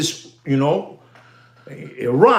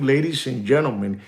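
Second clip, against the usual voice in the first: ordinary talk, nothing marked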